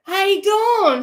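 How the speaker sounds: high, loud imitated holler; calling out. Ian calls to Dawn from departing police car, mock holler. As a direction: call to someone down the street. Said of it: "all you hear Ian go".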